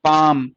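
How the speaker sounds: demonstrating a long-vowel word; long aa in palm. Shown as a demonstration. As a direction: stretch the आ of पाम. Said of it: "sound कैसी जा रही थी इसकी?".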